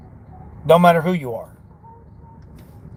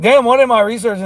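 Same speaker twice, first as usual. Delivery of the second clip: higher, louder self-quote; exasperated at yourself, playfully; mock exasperation; 6 semitones higher, 5 dB louder than usual. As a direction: act out catching yourself off track, half amused. Voicing own frustrated reaction while researching.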